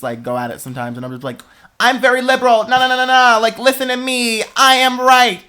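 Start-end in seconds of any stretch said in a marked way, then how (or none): 1.8-5.4 loud, high, insistent self-quote; raised voice, no, no, no; mock argument shout. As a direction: hammer it home like winning a family fight. Arguing with family, quoted self.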